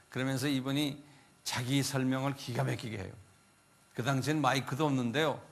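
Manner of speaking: ordinary talk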